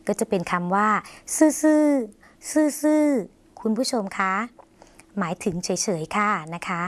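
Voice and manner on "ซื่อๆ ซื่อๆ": ซื่อๆ ซื่อๆ drawn out very slowly and higher; slow clear demo. Shown as a demonstration. Teacher models the word ซื่อๆ slowly for viewers. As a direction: spell out ซื่อๆ slowly for learners, pitched up.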